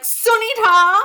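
loud, high, hammed-up greeting; relatives' accented call. Relatives spotting the supervisor at the box office, hammed-up accented call. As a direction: call out the name like a pushy relative. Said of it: "talk to them in the accent".

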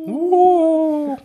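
high spooky ooh; a loud, high ghostly ooh. Acted. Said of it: "mysterious [name]".

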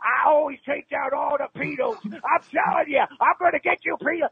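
high-pitched, strained prank-call threat; mock vigilante threat. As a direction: rant high like a cartoonish avenger. Prank caller threatens in raised voice.

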